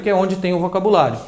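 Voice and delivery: ordinary talk, nothing marked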